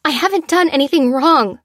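high, loud frightened protest. Acted. Lucy protesting to the soldier, high and loud. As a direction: plead your innocence. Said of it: "she protested".

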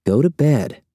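mildly forceful command. A narrator's reading. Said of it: "a trifle more forcefully".

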